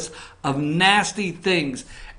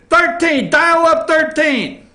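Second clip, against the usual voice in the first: high, loud call to the booth; 10 semitones higher, 5 dB louder than usual. Real, not acted. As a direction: holler for the next graphic. Calling to producer.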